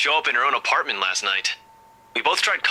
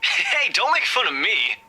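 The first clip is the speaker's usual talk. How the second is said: high teasing friend on phone; chirpy and teasing; cheerful buddy voice; 12 semitones higher, slower than usual. Acted.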